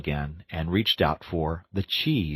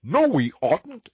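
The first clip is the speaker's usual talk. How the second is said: high, louder cut-in; shouting, mouth full; 11 semitones higher, 4 dB louder, slower than usual. Acted. Mole cuts off Rat while eating, high loud shout. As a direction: blurt it over a mouthful. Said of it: "shouted the Mole with his mouth full".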